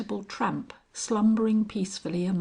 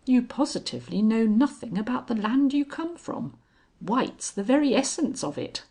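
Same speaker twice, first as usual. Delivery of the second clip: firm, raised insistence; a louder, higher correction; altered tone; 5 semitones higher, 4 dB louder than usual. A narrator's reading. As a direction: drive the point home, suddenly sharper. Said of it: "suddenly altering his tone".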